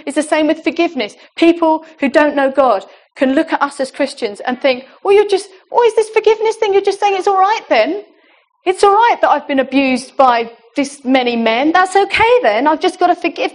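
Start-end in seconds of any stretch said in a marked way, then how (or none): 5.0-13.5 higher-pitched mock incredulity; incredulous objection. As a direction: voice a sceptic challenging the idea.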